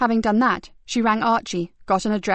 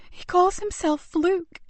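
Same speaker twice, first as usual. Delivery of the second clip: put-on slow, high, boyish voice; frightened boy; 10 semitones higher, slower than usual. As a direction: say the name with dread. Scared boy answers.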